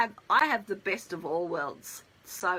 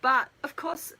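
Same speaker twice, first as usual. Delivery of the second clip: usual speaking voice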